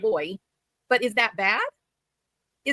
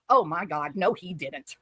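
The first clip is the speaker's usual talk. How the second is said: ordinary delivery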